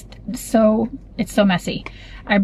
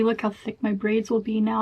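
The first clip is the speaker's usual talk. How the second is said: ordinary talk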